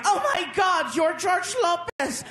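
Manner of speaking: high, excited fan shriek; freaked out. Acted. Dancer mistaking a comic for a star, high and freaking out. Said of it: "She freaked out".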